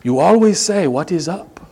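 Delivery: measured, careful imitation of a Kenyan accent; slightly high, deliberate mock-Kenyan accent; awful Kenyan accent. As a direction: do an amateur Kenyan accent, each word set apart. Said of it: "you'll pardon the awful accent".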